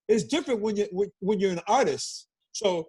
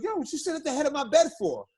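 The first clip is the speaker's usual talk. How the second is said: retold complaint; 8 semitones higher than usual. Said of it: "Joe looks at me and goes".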